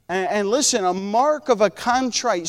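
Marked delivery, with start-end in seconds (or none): none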